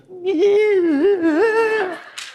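long, high-pitched imitated baby cry; copycat baby wail. Dad mimicking Hope copying the other baby, long mock wail. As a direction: mimic a baby joining in the crying, high and sustained. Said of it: "Hope looks at her and goes".

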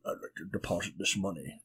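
a hint of Scottish Bond; Sean Connery impression. Friend retrying bank line, quiet mock Connery accent. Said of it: "see, that's pretty good".

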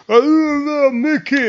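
hammed-up Rocky lament; Stallone mumble. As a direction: mumble it like a mourning boxer. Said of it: "he's like".

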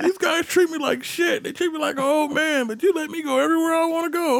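high-pitched mock whine; comic high-voiced complaint; complaining old driver. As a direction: imitate an old man pleading his case. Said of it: "he's like".

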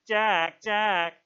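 loud, high mocking echo; bitter mimicry. A narrator's reading. Jack mocks Ralph's call, in bitter mimicry. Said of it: "sounded in bitter mimicry".

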